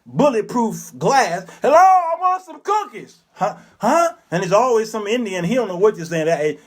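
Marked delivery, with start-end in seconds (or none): 1.6-3.2 high shout through thick glass; screaming through glass. Retold or imitated. Customer shouting an order through bulletproof glass, high shout. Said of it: "you gotta scream through this thick". 3.3-4.1 high mock clerk huh. Clerk can't hear.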